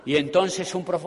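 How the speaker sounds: ordinary delivery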